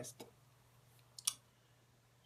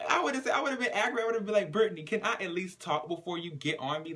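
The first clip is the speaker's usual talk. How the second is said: laughing; 7 semitones higher than usual. Real, not acted. Speaker laughing through talk.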